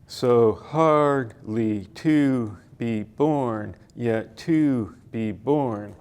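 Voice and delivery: slow, each "born" stressed hard; iambic line read out. Shown as a demonstration. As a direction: beat out "So hardly to be born" syllable by syllable. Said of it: "for example".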